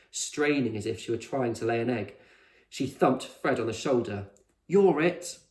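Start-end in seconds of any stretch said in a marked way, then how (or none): 4.7-5.4 higher, louder call; playful tag call. A narrator's reading. Con tagging Fred, playful call. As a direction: thump and call it.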